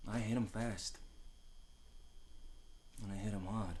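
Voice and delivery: quiet recollection. Real, not acted.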